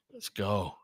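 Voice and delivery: normal talking voice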